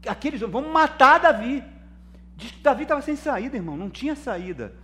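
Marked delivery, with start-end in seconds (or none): 0.4-1.6 higher, louder mock mob shout; angry mob cry. David's men turning on him, mock angry shout. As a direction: voice the furious men calling for blood.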